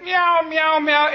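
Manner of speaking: a hint of a cat, half-played; imitating a cat. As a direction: meow like a cat to fool the guard. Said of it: "imitated the cat".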